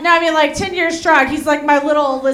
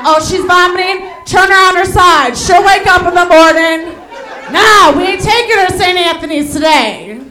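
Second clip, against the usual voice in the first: loud, high no-nonsense orders; hammed-up loud friend taking charge; mock bossy friend; 6 semitones higher, 7 dB louder than usual. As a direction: bark orders about the drunk friend. Voicing her friends' loud dismissal.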